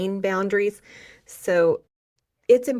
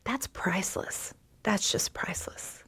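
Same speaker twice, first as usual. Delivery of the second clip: soft heartfelt whisper; 5 dB quieter than usual. Real, not acted.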